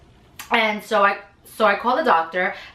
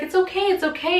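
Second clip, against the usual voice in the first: reassuring staff; 10 semitones higher, faster than usual. Retold or imitated. Hospital staff reassuring her.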